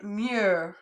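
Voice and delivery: Mieux said slowly and clearly; teacher models the word for the class. Shown as a demonstration. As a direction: model Mieux for students to repeat. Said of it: "I say it and then you say it".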